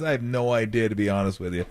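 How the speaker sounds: usual speaking voice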